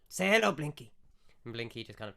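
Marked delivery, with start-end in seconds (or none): 0.1-0.9 loud, raised call to an animal; calling to a pet. Acted. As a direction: call out to the tortoise, in character. Keeper calls to his tortoise.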